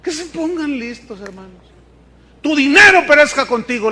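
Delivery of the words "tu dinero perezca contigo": louder forceful "Tu dinero perezca contigo"; preaching Pedro's curse louder; stern rebuke. Acted. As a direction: hurl the rebuke from the pulpit. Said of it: "le dice".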